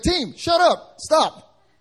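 high, loud mock rebuke. Pastor rebuking his praying team, loud. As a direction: snap at them to stop. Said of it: "I had to rebuke the team".

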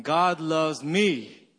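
"me" punched and drawn out at the end; stress on ME. Shown as a demonstration. Pastor leading congregation slowly, stress on "me". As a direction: land on the word "me". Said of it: "Let's do this slowly".

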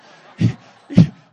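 sudden loud burst of laughter. Real, not acted. Pastor laughs at his aside about proud men, loud laugh.

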